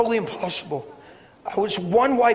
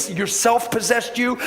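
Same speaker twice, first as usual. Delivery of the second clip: ordinary delivery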